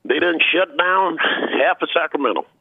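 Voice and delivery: put-on high old-timer voice, drawn out; old great uncle. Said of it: "he called me and said".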